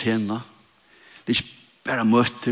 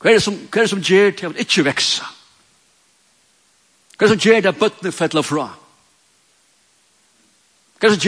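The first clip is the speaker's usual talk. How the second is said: preaching at full force; 5 semitones higher than usual. Acted. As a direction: drive each question home to the congregation. Preaches rising questions. (forkynning med full kraft)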